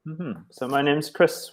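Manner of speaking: ordinary talk, nothing marked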